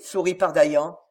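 ordinary delivery, nothing marked

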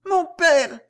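high, loud princess's cry. A narrator's reading. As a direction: call out eagerly to a father. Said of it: "s'écria la princesse".

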